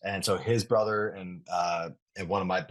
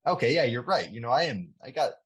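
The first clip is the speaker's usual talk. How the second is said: higher-pitched mock surprise, catching himself; sudden realization; 6 semitones higher, faster than usual. Athlete quoting his own realization, lightly surprised and higher. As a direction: quote your own lightbulb moment with a jump up.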